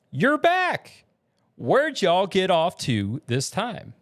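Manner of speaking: high, loud Southern greeting, then drawn out. Acted. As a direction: hail them in a Southern accent. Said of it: "in the Southern accent".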